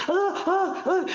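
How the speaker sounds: high, strained mock groaning of a sleeper; imitated sleep groans. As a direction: act out someone thrashing in a nightmare, high and strangled. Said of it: "You know how people like".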